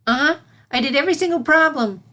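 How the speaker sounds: put-on bursting with pride, a bouncy kid; enthusiastic filly. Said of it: "Sunset nodded enthusiastically".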